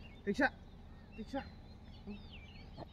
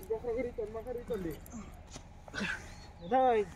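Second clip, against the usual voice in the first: ordinary talk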